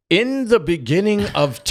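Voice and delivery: high, loud grand opening line; mock-solemn start of a lecture; hammed-up Sheldon beginning at the dawn of time; Sheldon launching in. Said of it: "and Sheldon goes".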